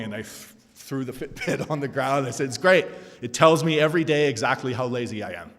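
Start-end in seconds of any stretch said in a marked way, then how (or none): 2.5-5.5 sarcastic. Retold or imitated. Sarcastic reply in story.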